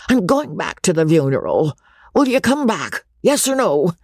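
put-on a gruff old squire, pushing hard; insistent old country gentleman demanding an answer; gruff old baronet. A narrator's reading. Baronet demands Becky return.

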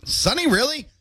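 can't believe it's sunny; surprised. Real, not acted. Surprised by the weather report.

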